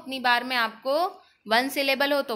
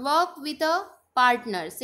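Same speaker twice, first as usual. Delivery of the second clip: ordinary talk, nothing marked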